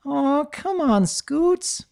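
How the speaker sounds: slow, high coaxing coo; filly coaxing her friend. A narrator's reading. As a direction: coax her sweetly to stay. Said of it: "Sweetie Belle cooed".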